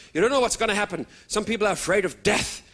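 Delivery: usual speaking voice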